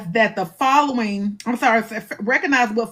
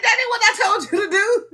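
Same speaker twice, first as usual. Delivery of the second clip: high, quick, amused rebuke; God correcting her; 9 semitones higher, faster than usual. Acted. God catches the speaker cheating, playful high rebuke. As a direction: play a chiding God through laughter. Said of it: "God said".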